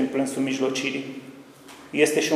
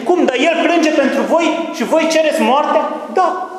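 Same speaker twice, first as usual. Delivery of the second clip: high, loud, slow shout of disbelief; astonished outcry; 13 semitones higher, 6 dB louder, slower than usual. Retold or imitated. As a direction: cry out in shock at the crowd. Preacher acts the astonished exchange. (strigăt uimit, înalt, rar și tare)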